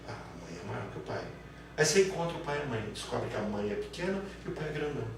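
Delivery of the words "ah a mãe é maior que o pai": low, soft aside; mock deduction, quiet. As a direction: murmur the conclusion. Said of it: "Aí você deduz o quê?". (dedução murmurada, mais grave)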